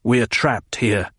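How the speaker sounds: regain iron control, slowly; controlled. A narrator's reading. Paul reining himself in to state the truth, controlled. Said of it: "the iron control return to him".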